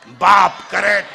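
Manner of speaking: shouted बाप, then correct; loud punchline. Acted. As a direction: hit the punchline loud to the crowd. Teasing crowd, loud punchline. (जोरात ओरडलेली punchline)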